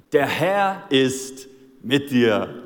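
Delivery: langsam gedehnter, spöttischer Segen; sarkastisch. Retold or imitated. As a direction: es nicht ernst meinen, ironisch ziehen. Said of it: "das ist purer Sarkasmus hier". (Saul segnet David voller Sarkasmus)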